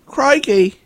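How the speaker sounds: high-pitched put-on British "Crikey."; mock British exclamation. Joking about what the haunted family says, high mock British exclaim. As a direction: squeak out "Crikey." like a cartoon Brit. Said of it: "all they're saying is".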